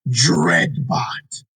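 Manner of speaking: ordinary talk, nothing marked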